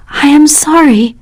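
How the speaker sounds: ordinary delivery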